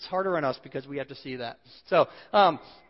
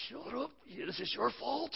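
slow, hushed mock snarl with a higher pitch; wife in labor snapping; 6 semitones higher, 9 dB quieter, slower than usual. Wife in labor snaps at husband coaching her breathing, hushed mock snarl. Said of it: "and she's saying".

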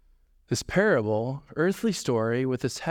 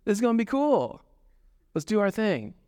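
higher, eager self-pep; gleeful self-talk; 6 semitones higher than usual. Retold or imitated. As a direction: psych yourself up happily. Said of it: "I can almost say it out loud".

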